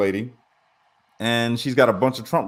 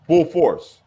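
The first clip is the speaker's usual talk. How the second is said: "Full force" punched louder and higher; emphatic repeat; 6 semitones higher, 5 dB louder than usual. Real, not acted. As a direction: hit both words hard. Emphatic repeat of his claim.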